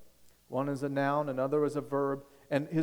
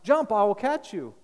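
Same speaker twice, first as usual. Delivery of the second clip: much higher and louder, calling up to the roof; father's shout; 9 semitones higher, 9 dB louder than usual. Retold or imitated. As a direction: shout up to the boy trapped on the roof. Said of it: "so he shouted to the little boy".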